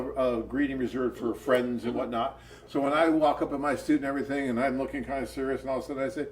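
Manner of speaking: ordinary delivery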